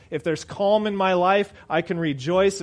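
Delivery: ordinary delivery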